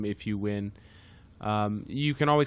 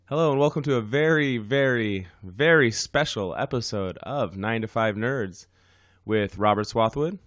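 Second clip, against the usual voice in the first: show intro; 2 semitones higher, 4 dB louder than usual. Acted. Podcast intro announcement.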